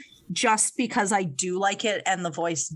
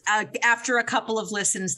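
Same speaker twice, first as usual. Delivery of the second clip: usual speaking voice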